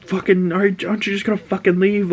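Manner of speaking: put-on high-pitched teen girl snapping back; angry Ellie. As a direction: pitch it way up and spit it out. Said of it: "she's like".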